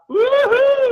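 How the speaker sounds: high loud cheering whoop; excited whoop. Real, not acted. Cheer after singing.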